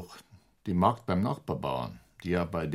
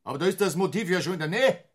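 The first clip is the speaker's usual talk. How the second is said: high, scoffing disbelief; pitched up high, mocking his choice of word; incredulous; 10 semitones higher, 3 dB louder than usual. Superior scoffing at the inspector, high and incredulous.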